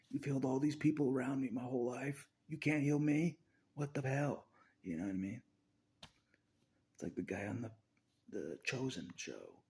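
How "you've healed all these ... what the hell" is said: loud, higher, outraged complaint; angry rant at Jesus. Retold or imitated. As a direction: yell it like a betrayed believer. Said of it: "I'm like".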